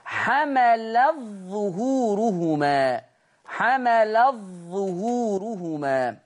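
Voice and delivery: "حملت ظهورهما" بإدغام التاء في الظاء ببطء شديد; تُنطق "حملت ظهورهما" بظاء مشددة بلا تاء; حملت ظهورهما بالإدغام. Shown as a demonstration. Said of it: "لا أثر للتاء". (تلاوة مرتلة بطيئة مدغمة)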